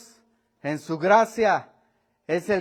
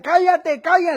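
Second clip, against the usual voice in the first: sharp loud higher hush; hushing shout; 9 semitones higher, 6 dB louder than usual. Retold or imitated. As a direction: crowd shouting to hush the blind man. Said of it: "Y le decían".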